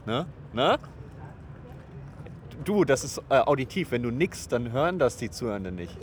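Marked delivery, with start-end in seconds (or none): none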